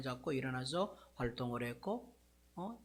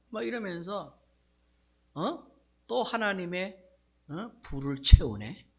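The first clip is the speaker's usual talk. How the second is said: normal talking voice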